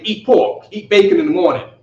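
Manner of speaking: ordinary delivery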